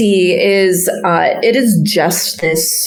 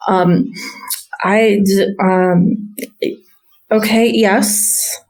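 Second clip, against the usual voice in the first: hesitant, stammering, slow yes; nervous stable boy; 3 semitones higher, slower than usual. Retold or imitated. Stable boy hesitantly agreeing to the stranger, stammering. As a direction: agree reluctantly, unsure.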